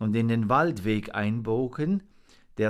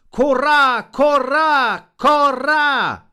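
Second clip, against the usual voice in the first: very high, shouted raven alarm; loud warning call; 13 dB louder, much slower than usual. A narrator's reading. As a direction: caw out the alarm at full force. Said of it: "rief seinen Warnruf".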